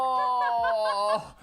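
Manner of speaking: long, high dismayed wail; hammed-up "oh" of agony at busting; mock physical reaction. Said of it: "there's, like, a physical reaction".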